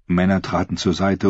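ordinary delivery

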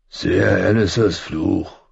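slow gruff grumble; grumbling. Acted. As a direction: grumble it slowly like an old man. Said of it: "brummte er".

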